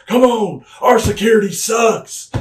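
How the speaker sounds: high, loud, slow mocking yell; mock outraged shout. JBL taunting about the guardrail, hammed-up shout. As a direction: bellow the complaint like a heckling heel.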